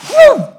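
long, loud high "whew"; imitated whoosh. Rider released into the drop, loud drawn-out whoosh. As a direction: swoosh like a plunging swing.

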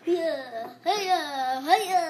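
loud effortful grunting cries; straining shout. Real, not acted. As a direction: heave it out with effort. Effortful cries moving chair.

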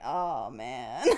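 lightly voiced clueless driver; mocking bystander. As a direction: do a dumb passer-by, low. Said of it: "they're like".

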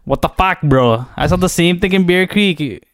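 lightly put-on Surrey Jack accent. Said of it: "Should I do this in Jack voice?".

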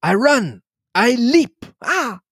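high, slow, gleeful crowing; high triumphant cries of joy; exultant little foreigner. Acted. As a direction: crow with giddy delight like an excitable little detective. Poirot exults after good news.

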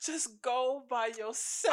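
slow drawn-out mock advice; slowly imitating a friend; slow quote. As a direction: stretch each word, teasing. Quoting River's advice.

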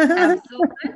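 a burst of loud, high enthusiasm; excited yes. Real, not acted. Eager reply to a card request.

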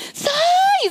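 very high-pitched cry out; acted shriek for help; re-enacted panicked yell. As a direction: call out for a husband in alarm. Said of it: "saya teriak sama suami saya".

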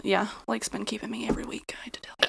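secretive whisper. Acted. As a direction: lean in and whisper it. Confiding a secret about Lake.

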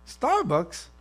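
high, questioning disbelief; mock-puzzled query; incredulous. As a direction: balk at it, pitch jumping up. Acts out balking at the voice.

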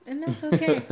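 bursts out laughing mid-sentence. Real, not acted. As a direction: laugh through the words. Laughing at the toddler story.

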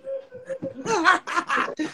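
high-pitched cackle; high laugh. Real, not acted. Streamer laughing about his avocados, high cackle. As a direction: burst out laughing.

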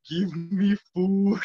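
slow, drawn-out monster groan of a plea. Acted. Bakugan monsters begging for food, slow monster voice. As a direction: drag it out like a hungry giant ball. Giant Bakugan waddling toward Godzilla.

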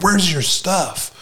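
high, drawn-out eager demand; fired up. Retold or imitated. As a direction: imitate a hyped-up guy, stretching the words. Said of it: "all fired up".

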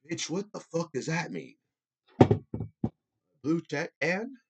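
high indignant retort to a troll; incredulous outburst. Real, not acted. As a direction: fire it back in disbelief. Mocking a dumb comment.

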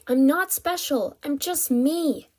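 high cry, slowing at the end; screamed protest. Acted. Captive insists she is ordinary, high-pitched cry. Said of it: "she screamed".

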